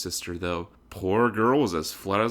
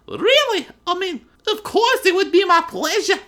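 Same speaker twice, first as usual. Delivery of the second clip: haughty heiress greeting; 9 dB louder than usual. A narrator's reading. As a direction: greet her rival from on high. Weiss thrilled by date.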